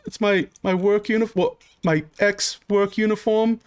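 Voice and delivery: high, stumbling explanation; flustered suspect. Acted. Suspect explaining the outfit to the guard, high and flustered.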